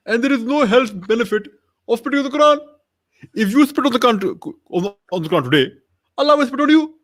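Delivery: high-pitched preaching climax; mock-sermon verdict, pitched far above normal talk; Zakir Naik. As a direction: proclaim each point high, like a scolding preacher. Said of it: "what would Zakir Naik say to Armin".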